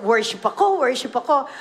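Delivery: usual speaking voice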